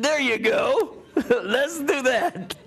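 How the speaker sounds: slow, high, laughing through the words. Real, not acted. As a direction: chuckle it out, amused. Laughing, agreeing to tell story.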